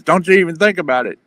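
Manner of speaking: a mock trucker snapping a warning; threatening yell. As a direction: bark the threat at him.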